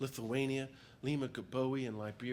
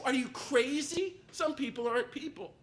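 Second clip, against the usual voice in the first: mocking scoffers; 13 semitones higher, 5 dB louder than usual. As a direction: sneer like the crowd ridiculing him. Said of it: "They said".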